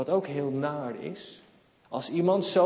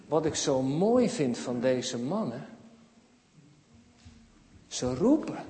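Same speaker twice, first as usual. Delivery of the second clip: usual speaking voice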